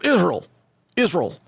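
Israel squashed to IZ-RULL, mocking; two-syllable pronunciation. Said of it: "Two syllables".

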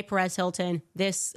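ordinary talk, nothing marked

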